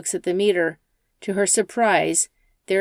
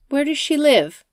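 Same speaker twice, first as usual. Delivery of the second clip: higher, louder probing question; wary Rachel; 8 semitones higher, 5 dB louder than usual. Acted. Rachel probes the driver about his grandmother, guarded. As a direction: keep him talking, guarded.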